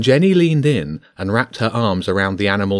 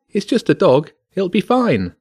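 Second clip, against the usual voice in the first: put-on high-pitched child's voice; much higher, dismissive girl; young girl; 10 semitones higher than usual. A narrator's reading. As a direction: pipe up in a little girl's voice. Girl in audience calls out, voiced.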